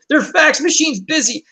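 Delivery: 1 mock exasperation. Frustrated quote about fax.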